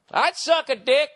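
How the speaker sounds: put-on high, loud, slow drunken shout; mocked drunk guy. Drunk guy starting up on the bus again, loud imitation. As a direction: belt it out high, stretching each word. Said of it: "he started going again".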